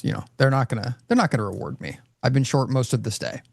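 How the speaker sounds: usual speaking voice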